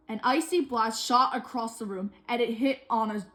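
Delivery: usual speaking voice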